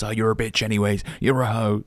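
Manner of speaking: mock sneering insult; lightly voiced sour guy lashing out; bitter rejected guy. Imitating a spurned man insulting the woman, mild sneer. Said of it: "don't be one of those guys".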